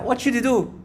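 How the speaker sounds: ordinary talk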